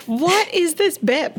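high, slow baffled question; incredulous. Retold or imitated. Watching the movie, she mocks her own confusion, high and slow. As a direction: ask it like you can't believe the joke. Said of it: "and I'm like".

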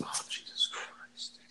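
a slow, hushed gasp of disbelief; shocked whisper. Real, not acted. Hearing an eighteen-year-old was stabbed, a shocked whisper. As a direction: breathe it out in real shock.

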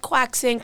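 "quaxin" as KWAK-sin; mangled croissant. Shown as a demonstration. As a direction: say "quaxin" with a hard kwack. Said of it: "however you wanna say it".

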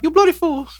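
high-pitched duck squawk; imitating the duck. Podcast host mimicking the creepy talking duck. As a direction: copy the duck's screechy catchphrase. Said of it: "have that as a drop".

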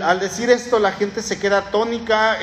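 ordinary delivery

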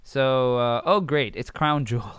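normal talking voice

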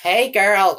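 put-on high, drawn-out Valley girl greeting; Valley girl accent. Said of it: "The Valley girl accent".

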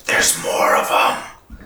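whisper-yelling the scouting report; stage whisper. Acted. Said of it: "I'm gonna go".

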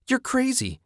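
very high-pitched shriek; high, frightened outcry without extra volume; panicked shriek. A narrator's reading. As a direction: shriek it at an attacker. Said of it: "Susan shrieked".

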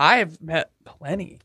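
words pushed out on a burp. Real, not acted. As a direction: talk through a burp. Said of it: "Everybody's just talking through burps right now".

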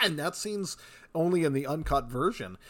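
ordinary talk, nothing marked

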